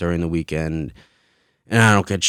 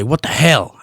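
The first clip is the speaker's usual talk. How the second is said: quoting a curse; 6 semitones higher, 5 dB louder than usual. Retold or imitated. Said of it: "even hearing people say".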